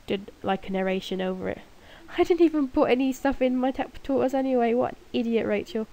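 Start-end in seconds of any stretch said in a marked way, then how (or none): none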